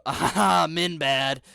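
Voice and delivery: loud dumb caveman grunt; mocking men hater. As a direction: bellow it like a caveman. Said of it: "some men hater on Twitter go".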